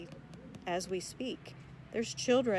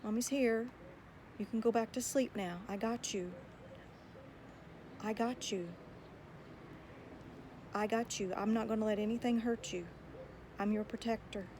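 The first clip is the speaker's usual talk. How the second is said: soft, hushed reassurance to a child woken from a bad dream; softly, as a mommy calming her little one; comforting mother; 6 dB quieter than usual. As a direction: soothe a frightened child back to sleep. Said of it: "you're gonna say".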